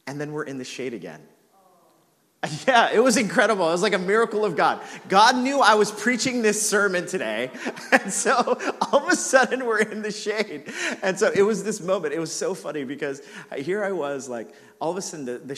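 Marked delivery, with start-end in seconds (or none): 2.6-12.1 real laughter through the words, louder and higher; giggling at the memory; laughing delightedly while telling it. Real, not acted. Laughing at the miracle.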